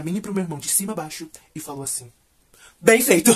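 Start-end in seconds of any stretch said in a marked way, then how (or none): 2.8-3.3 curt mom verdict. Retold or imitated.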